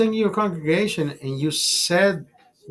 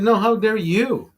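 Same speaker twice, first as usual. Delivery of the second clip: higher, slower 'No, how dare you?' thrown back; retorting to the pastor, higher and slower; mock retort; 7 semitones higher, 3 dB louder than usual. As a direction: turn her words on her, slow and pointed. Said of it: "I said".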